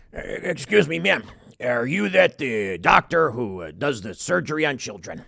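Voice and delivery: a hint of a winded cop addressing the doctor; portly cop voice. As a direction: play a tired overweight cop politely asking. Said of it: "a single overweight, portly cop".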